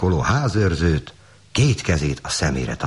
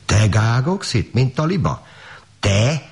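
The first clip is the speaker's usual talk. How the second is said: farmer's wife crying out, pitch raised; shocked exclamation; 8 semitones higher than usual. A narrator's reading. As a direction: draw it out high in disbelief. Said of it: "kiáltotta a gazdasszony".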